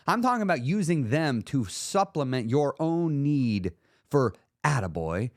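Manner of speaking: ordinary talk, nothing marked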